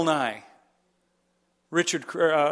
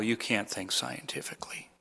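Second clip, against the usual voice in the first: whisper; 9 semitones lower, 10 dB quieter than usual. Pretend. Mocking the opponents' claim.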